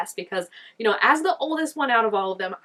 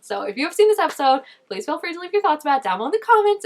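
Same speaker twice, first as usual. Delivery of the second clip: brighter, faster sign-off pitch; upbeat outro; 8 semitones higher, 7 dB louder, faster than usual. Acted. Vlogger asking viewers for comments, upbeat outro read. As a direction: sell the call to comment.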